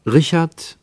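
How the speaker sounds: ordinary talk, nothing marked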